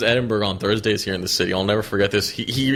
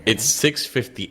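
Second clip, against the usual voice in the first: usual speaking voice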